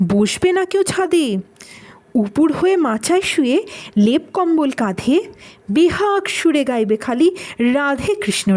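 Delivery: measured verse with swooping intonation; poem recitation. Acted. Warning listeners about Kumro Potash, in lilting, dramatic recitation. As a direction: recite line by line, pausing after each.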